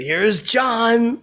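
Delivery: high, loud drawn-out announcer call; announcer intro. Retold or imitated. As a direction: belt it like the show intro. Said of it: "I go like that".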